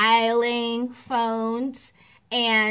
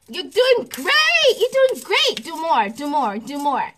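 much higher, louder, gushing praise; ego egging on; 6 semitones higher, 7 dB louder than usual. Retold or imitated. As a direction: cheer the listener on like a greedy ego.

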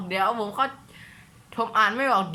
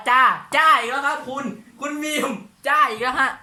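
higher and louder, calling out "จ้า" again; teasing complaint, raised and loud; exasperated teasing call-out at a viewer; exasperated mock call-out; 5 semitones higher, 6 dB louder than usual. Exasperated at the commenter's repeated "จ้า.

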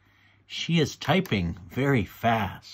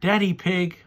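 higher-pitched call to someone; 7 semitones higher, 4 dB louder than usual. A narrator's reading. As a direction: call out lightly for help. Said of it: "Mummy calls".

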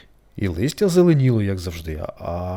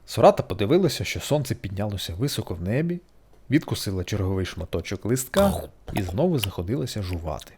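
plain reading voice

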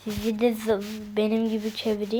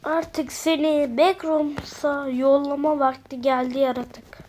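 normal talking voice

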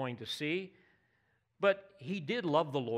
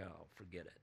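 much softer, dropped and low exasperated Oh, forget it; exasperated give-up; 9 semitones lower, 17 dB quieter, faster than usual. Retold or imitated. Preacher voicing frustration with someone who won't get it, fed up. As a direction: toss it away with a tired sigh. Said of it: "throw up your hands and say".

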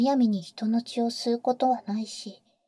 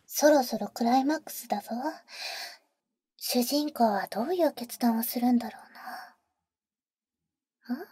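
hushed whisper. Acted. Whispering to the boy during the climax. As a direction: keep it hushed so the movie plays on.

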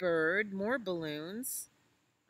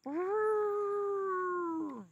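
high, loud brassy trumpet call; elephant trumpet; 6 dB louder than usual. Shown as a demonstration. Mama showing calf how to trumpet, loud and high. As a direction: blast it out like an elephant's trunk. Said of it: "you can trumpet like this".

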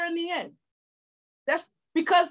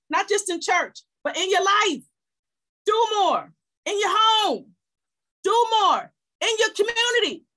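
rising, louder and higher with every call; preaching at full force; 6 semitones higher, 3 dB louder than usual. Acted. Pastor rallying her church to do more, building preaching cry. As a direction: hammer each "do more" home like a revival preacher.

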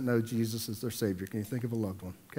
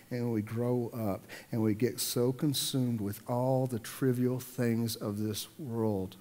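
slow, softer, earnest; softer reflective; 2 semitones lower, 6 dB quieter than usual. Acted. Pastor urging the congregation tenderly, softer and slower. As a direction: let it sink in gently.

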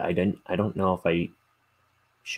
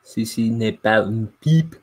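louder, confident French accent; French reading voice; 2 semitones higher, 8 dB louder than usual. Shown as a demonstration. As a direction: nail the French phrase. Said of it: "Oh, nailed it".

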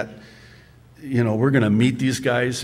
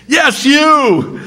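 laughing reply; 14 semitones higher, 7 dB louder than usual. Retold or imitated. Angel answers Gideon.

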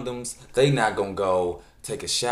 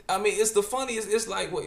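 excited disbelief; 13 semitones higher than usual. Real, not acted. Laughing disbelief at the quote.

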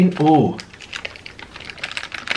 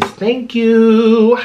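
mock prim thank you; 5 semitones higher, 4 dB louder than usual.

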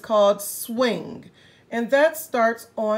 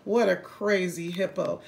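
ordinary delivery

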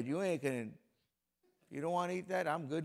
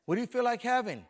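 higher, louder mock wife; wife asking; 8 semitones higher, 10 dB louder, faster than usual. Preacher acts out his wife asking what he wants to eat, higher and louder. Wife's question acted out.

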